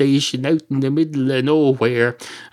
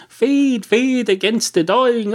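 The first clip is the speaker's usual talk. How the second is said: high, dramatic recital of the misquoted line; mock poem recital; 11 semitones higher, 3 dB louder, slower than usual. Comedian misquoting the poet, dramatic high recital. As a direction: declaim the botched line loftily, slowed down.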